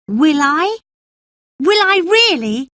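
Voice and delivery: put-on high, rising, disbelieving child voice; he can hardly believe it; eager hopeful boy. Colin's voice, eager.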